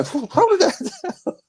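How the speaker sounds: very high, slow, strained coach voice; Duke impression. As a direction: drag it out like a desperate cornerman. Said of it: "the face Duke makes".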